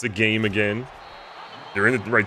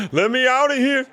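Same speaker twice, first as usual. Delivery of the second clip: mock scream. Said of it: "Coach McMurphy is just screaming".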